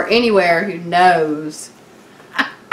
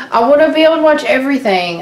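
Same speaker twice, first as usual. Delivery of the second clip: higher, louder, fast and eager; fast excited rant; 6 semitones higher, 4 dB louder, much faster than usual. Real, not acted. Wife ranting to listeners about British TV, fast and eager. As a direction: rush it out in eager frustration.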